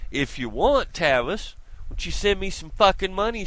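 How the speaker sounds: ordinary talk, nothing marked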